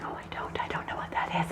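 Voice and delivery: tiny, voiceless whisper; whispered dread. Retold or imitated. Viewer answering the man holding up the torture tool, fearful whisper. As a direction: whisper it as if scared to hear the answer. Retelling her frightened answer to villain.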